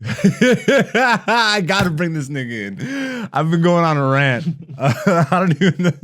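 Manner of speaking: laughing through the words. Real, not acted. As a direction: chuckle while talking. Laughing at own rant.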